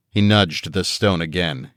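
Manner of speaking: usual speaking voice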